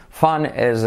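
usual speaking voice

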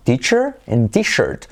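"Teacher" with ch, "t-shirt" with sh; CH against SH. Shown as a demonstration. Teacher showing the difference, careful ch versus sh. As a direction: slowly contrast the two middle sounds. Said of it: "diferenciando la S H y la C H".

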